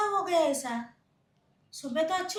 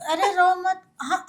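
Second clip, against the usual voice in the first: ordinary talk, nothing marked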